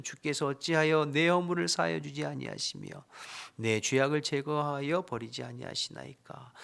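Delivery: dramatic lament reading of Job; loud, earnest scripture reading. Acted. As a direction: read the verse as Job's anguished plea. Reads Job's lament aloud.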